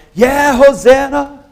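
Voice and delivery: high, loud, drawn-out cheer; excited cheer. Acted. The Sunday crowd greeting Jesus, ecstatic cheering. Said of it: "Sunday, we're like".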